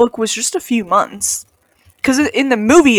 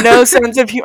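normal talking voice

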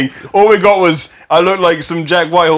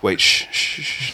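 shushing; 8 semitones lower, 9 dB quieter than usual. Real, not acted. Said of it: "I need to get into character".

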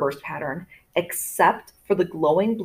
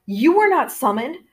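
higher-pitched, frightened father; trembling voice; 7 semitones higher, 3 dB louder than usual. A narrator's reading. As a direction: protest in alarm, pitched up. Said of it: "my father's voice trembled".

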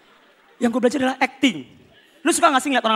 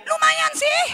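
choking back pain; strained; much slower than usual. Retold or imitated. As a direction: squeeze it out in pain. Strained reply after punch.

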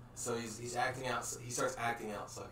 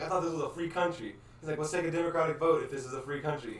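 mock indignation; 6 semitones higher, 6 dB louder than usual. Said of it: "starts getting really mad".

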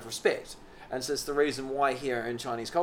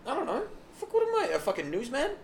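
much higher squeaky exasperated reply; mock squeaky voice; 9 semitones higher, 4 dB quieter than usual. Friend answering cohost, squeaky mock voice. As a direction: keep up the silly squeak.

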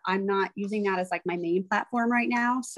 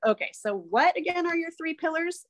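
business coach asking, pitched up; imitating the coach; 10 semitones higher than usual. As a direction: lightly mimic a brisk coach quizzing. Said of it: "And he's like".

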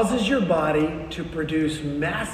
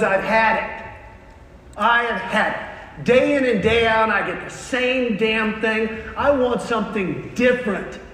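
hammed-up exasperated rant, punching each phrase; mock outburst at the end of his patience; fed up; 4 semitones higher than usual. Worker's fed-up outburst, acted.

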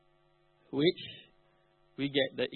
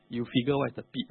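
normal talking voice

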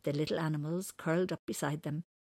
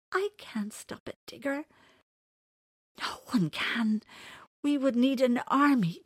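near tears; 6 semitones higher, 5 dB louder than usual. Acted. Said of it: "J C wanted to cry".

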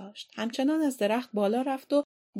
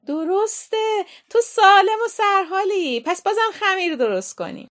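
delighted approval; 12 semitones higher, 9 dB louder than usual. Acted. Aunt approving Lizzie's spelling, delighted and loud. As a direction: declare it triumphantly.

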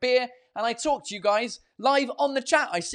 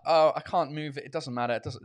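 ordinary talk

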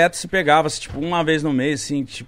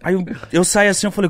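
normal talking voice

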